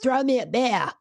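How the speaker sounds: high, far-off bar call; mock shout. Brock calling to the bartender, a mock shout. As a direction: yell it across the bar. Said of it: "Brock had to shout".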